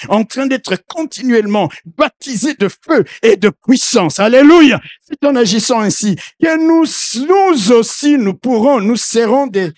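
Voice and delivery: loud, impassioned sermon delivery; fervent preacher calling out; preaching at full force. Acted. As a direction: preach it out to the whole church. Preacher rising again.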